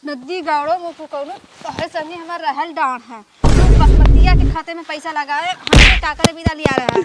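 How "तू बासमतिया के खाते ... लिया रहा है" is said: angry scolding. Acted. Wife scolds husband over money.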